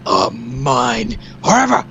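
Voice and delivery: slow, sinister villain boast; lightly voiced gloating villain. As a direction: drag it out like an evil sorcerer. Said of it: "he's like".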